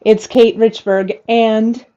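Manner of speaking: usual speaking voice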